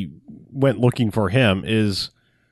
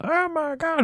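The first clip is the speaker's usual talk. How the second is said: high, soft mock scream; slow-motion freak-out; 6 dB quieter than usual. Partygoer seeing the killer walk out, high-pitched mock slow-motion panic. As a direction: squeal it thinly, imitating slow-motion horror. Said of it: "shot in slow motion style".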